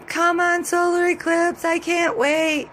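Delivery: can't contain the thrill; real excitement. Real, not acted. Vlogger waiting for the solar eclipse, real excitement. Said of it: "I'm getting even more excited".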